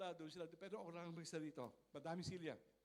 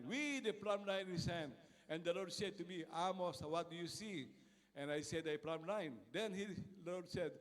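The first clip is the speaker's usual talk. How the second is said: luid en plechtig voorgelezen; scripture reading; 6 dB louder than usual. Acted. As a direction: lees de Bijbeltekst met gezag. Reads Amos scripture aloud.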